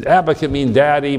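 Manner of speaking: normal talking voice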